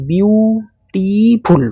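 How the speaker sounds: বিউটিফুল very slow, in three separate stretched beats; syllable by syllable. Shown as a demonstration. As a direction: break বিউটিফুল up as বিউ... টি... ফুল. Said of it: "কেটে কেটে করতে হচ্ছে".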